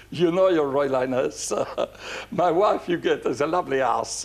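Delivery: imitating a suave accented hairdresser; René's foreign-accented voice. Hairdresser to the princess at dinner, imitated suave foreign accent. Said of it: "one of those sexy voices".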